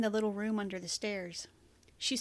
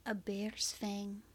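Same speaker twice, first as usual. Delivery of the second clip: whispering; 6 dB quieter, much slower than usual. Acted. Tender bedside talk, hushed.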